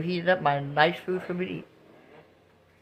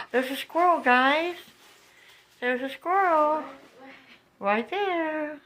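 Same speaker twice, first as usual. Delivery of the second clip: excited; 10 semitones higher, slower than usual. Real, not acted.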